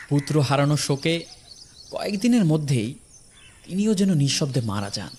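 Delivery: ordinary delivery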